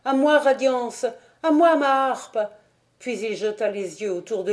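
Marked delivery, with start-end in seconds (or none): 0.0-2.4 higher, loud desperate call for help; terrified loud plea, pitched high; frantic cry. A narrator's reading. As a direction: cry out in panic to Radiance. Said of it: "s'écria".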